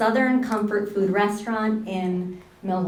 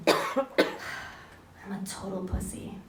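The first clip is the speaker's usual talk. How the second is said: hushed, slow, dejected confession; hanging-head admission; 9 dB quieter, slower than usual. Retold or imitated. Carrie chickening out at the sleepover, slow ashamed murmur. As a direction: own up softly and slowly, head hung. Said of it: "hanging her head".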